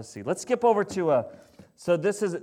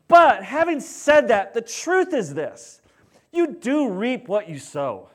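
preaching at full force; 5 semitones higher, 4 dB louder than usual. Acted. Pastor to congregation, preaching at full force.

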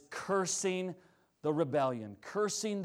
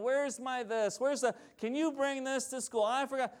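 high-pitched imitation of kids whining; mock kid whine, pitched up; 7 semitones higher, 3 dB quieter than usual. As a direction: whine out petty demands like a kid.

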